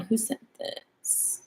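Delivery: slow whispered aside; whispering. Real, not acted. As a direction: breathe it out under your breath.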